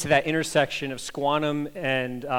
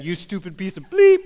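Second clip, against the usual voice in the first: louder, half-hammed angry insult; mock road-rage insult; 3 semitones higher, 6 dB louder than usual. Pastor mimics himself yelling at a driver, mock angry. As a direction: spit out the censored insult. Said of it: "not much better than".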